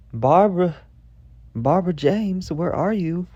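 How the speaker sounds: high, loud drawn call for a lost pet; calling the cat. Retold or imitated. Child outside calling her lost cat, calling out high and loud. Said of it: "I'm calling the cat".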